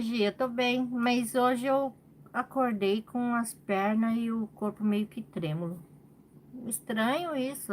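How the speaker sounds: usual speaking voice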